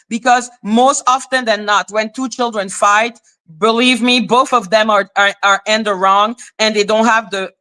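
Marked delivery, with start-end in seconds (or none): none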